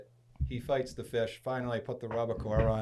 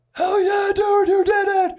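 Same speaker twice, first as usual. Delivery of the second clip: huge high-pitched celebratory yell; pumped up; 12 dB louder, slower than usual. Retold or imitated. Friend cheering him for reaching the rock, pumped-up shout. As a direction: whoop it out at full volume, thrilled for a buddy. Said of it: "He's, like, all pumped up".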